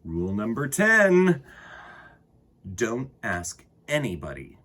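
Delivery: put-on unhurried read-aloud for kids; storybook reading. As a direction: read it like storytime to little ones. Reads a children's book aloud.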